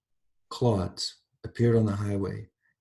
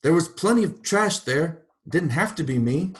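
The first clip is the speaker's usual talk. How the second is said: higher, louder bitter complaint; aggrieved protest; 8 semitones higher, 6 dB louder than usual. A narrator's reading. Mrs. Turpin protesting to God, bitter. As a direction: accuse the heavens.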